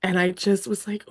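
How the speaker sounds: ordinary talk, nothing marked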